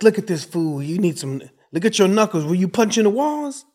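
loud, high-pitched mock roast; playful neighborhood ribbing; making fun. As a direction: tease a friend loudly, pitch climbing into the question. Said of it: "up your skills on making fun back".

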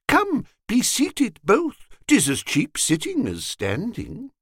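high-pitched, jovial invitation; hearty invitation. A narrator's reading. Mister Dixon bids his friends be seated, heartily inviting. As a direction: usher them in with a high, lilting call.

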